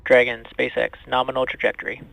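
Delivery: crisp call over a radio loop; mission control radio. Real, not acted. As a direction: read it like a flight controller on comms. Launch comms over radio loop.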